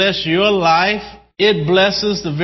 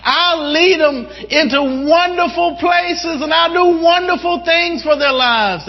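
high, eager voice of God; impassioned pleading, pitch climbing high; excited exclamation; 8 semitones higher than usual. Acted. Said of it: "So He gets excited".

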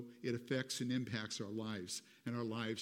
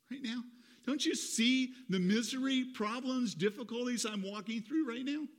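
much higher, whiny pleading complaint; self-pitying plea to God; 11 semitones higher, 4 dB louder than usual. Acted. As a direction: beg God like nobody cares. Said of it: "Let me say it in another way".

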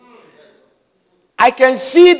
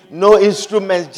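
usual speaking voice

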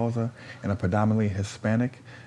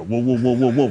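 loud stand-in babble for a rant; mock tirade in repeated nonsense syllables; retold flashing; 3 semitones higher, 5 dB louder than usual. Man telling his girl how he flashed, mock ranting.

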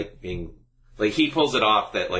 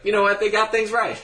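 high mock voice; 12 semitones higher, 4 dB louder than usual. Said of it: "was like".

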